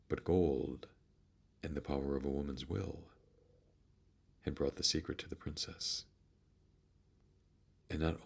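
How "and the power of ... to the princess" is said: ordinary delivery, nothing marked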